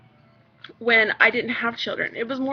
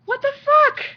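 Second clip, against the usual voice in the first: much higher, louder incredulous outburst; indignant disbelief; 13 semitones higher, 5 dB louder than usual. Real, not acted. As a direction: throw it back in disbelief. Indignant retort in rant.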